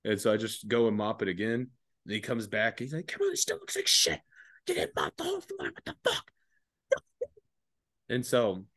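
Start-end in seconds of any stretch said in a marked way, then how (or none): 3.1-6.3 very high, strained mock rant; hammed-up high-pitched chewing-out; imitated angry corporal. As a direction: scold like a hysterical superior. Corporal berates him over the mopping.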